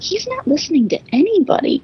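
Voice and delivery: high-pitched mock exasperation; exasperated mock complaint. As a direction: whine it in a high, put-on voice. Acting out her exasperated movie reaction.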